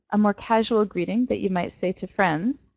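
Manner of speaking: normal talking voice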